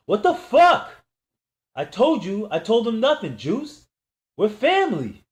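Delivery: very high, loud desperate cry; begging Juice, calling him family; panicked pleading. Acted. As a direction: scream a plea as the gun is cocked. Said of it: "I pleaded".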